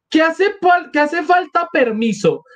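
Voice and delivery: high-pitched outcry in character; acted high wail of indignation; indignant cry. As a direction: cry out high, outraged at being questioned. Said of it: "Gritó Katerina Ivanovna".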